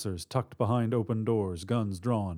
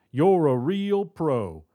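sarcastic shout; 7 semitones higher, 6 dB louder than usual. A narrator's reading. Robber to partner and mother, impatient shout. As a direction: jeer at partner, then order impatiently. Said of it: "China shouted".

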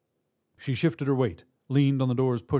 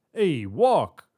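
loud, raised-pitch command; shouted; 5 semitones higher, 6 dB louder than usual. A narrator's reading. Robber to stumbling hostage, shouted command. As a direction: shout to get someone moving. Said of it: "China shouted".

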